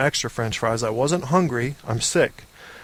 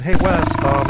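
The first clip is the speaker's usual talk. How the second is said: loud greeting; 2 semitones higher, 5 dB louder than usual. Retold or imitated. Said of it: "and then I said".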